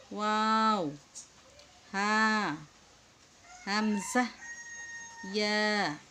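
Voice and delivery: loud, stretched Arabic letter names; drawn-out letter recitation. Shown as a demonstration. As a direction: call out each letter long and clear like a Qaida teacher. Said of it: "আমরা এই হরফগুলো পড়লাম". (টেনে টেনে জোরে হরফ পড়া)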